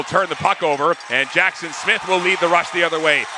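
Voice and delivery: normal talking voice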